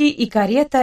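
ordinary delivery

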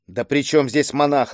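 ordinary delivery

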